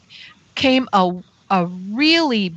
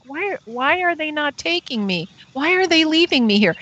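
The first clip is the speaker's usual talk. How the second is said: dismayed pleading; 7 semitones higher than usual. Retold or imitated. Retells dismay at being left.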